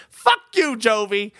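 mock outrage. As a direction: hurl it like a joke insult.

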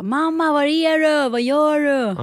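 put-on slow, high, loud child calling mum; a boy yelling through the house; Stockholm-accent shout. Mother imitates son shouting for her, slow and loud. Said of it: "han skriker hemma bara".

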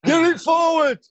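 very high shouted terrace cry; fan shout. Retold or imitated. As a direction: yell it from the stands. Mimicking fans at the match.

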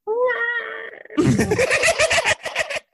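high screeching dinosaur roar; baby T-Rex roar. Shown as a demonstration. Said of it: "It sounds like a baby T-Rex".

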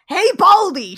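a loud, rude hey across the diner; rude call out. Retold or imitated. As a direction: bark it at the waiter like a brat. Rude Jem addressing maitre d'.

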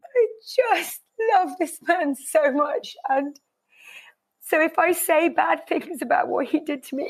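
words pushed out through real tears; real crying. Real, not acted. Defending her husband to Sam, through tears. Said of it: "Oh God, I'm gonna cry".

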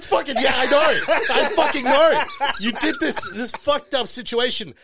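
high loud laughing rant; laughing outburst. Real, not acted. Storyteller laughing while retelling his outburst at her. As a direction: laugh through the indignant retort. Retold angry reply, laughing.

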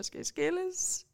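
high, slow, soft frightened question; retold scared question. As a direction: play your younger self, scared. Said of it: "og siger".

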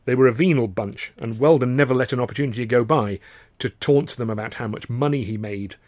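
ordinary delivery, nothing marked

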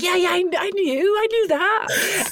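high, squeaky cover-up, laughing; mock knowing. Pretends she got the joke.

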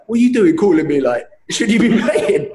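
high, incredulous questioning breaking into laughter; baffled mate. Retold or imitated. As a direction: imitate a bewildered friend on the phone. Retold surprised phone call from mate.

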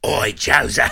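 gruff shout across the street; gruff call. Retold or imitated. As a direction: bark it like a gruff stranger. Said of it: "he heard this gruff voice".

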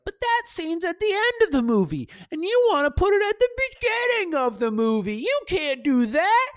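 high, anxious whiny voice, slowing at the end; scared inner voice. Retold or imitated. Filmmaker voicing his scared side doubting the edit, high and whiny. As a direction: squeak out the worry like a nervous alter ego. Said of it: "Scared [name] was like".

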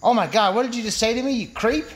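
high-pitched, affronted woman's voice; offended woman. Acted. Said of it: "She says".

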